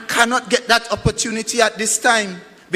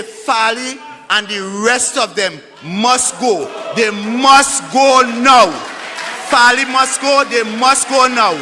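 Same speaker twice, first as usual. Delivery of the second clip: shouted rally demand; loud soapbox ranting; 4 dB louder than usual. Real, not acted. Rally speech demanding resignation.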